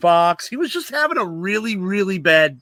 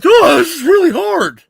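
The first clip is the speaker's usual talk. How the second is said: high, loud, hammed-up complaint; exaggerated crybaby whine, pitched way up; mock whining owner; 10 semitones higher, 7 dB louder than usual. Host showing what an owner never sounded like, mock whine. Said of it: "Bill Bidwill was never like".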